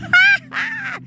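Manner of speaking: very high, loud cackle; high burst of laughter. Real, not acted. Preacher cracking up at her own joke, high laugh.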